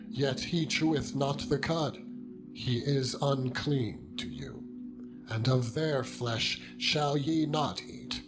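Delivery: usual speaking voice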